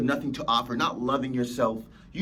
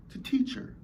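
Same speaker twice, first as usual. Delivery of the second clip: breathy whisper, higher; 8 semitones higher, 5 dB quieter than usual. Shown as a demonstration. Speaker whispering that pain is a teacher. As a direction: whisper it like a secret. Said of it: "repeats line softer then whispered".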